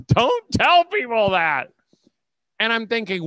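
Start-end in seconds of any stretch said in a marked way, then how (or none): 0.1-1.7 high, loud, slow mock warning; mock scandalised hush. Couple warning the speaker off the topic, high mock scold. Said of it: "in unison, and went".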